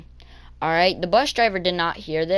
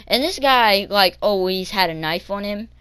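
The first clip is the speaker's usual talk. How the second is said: normal talking voice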